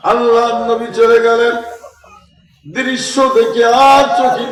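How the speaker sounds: high, loud wailing sermon; full-force preaching. Acted. Preacher tells of the Prophet finding Hasan and Husain, wailing preaching. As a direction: call out high and impassioned.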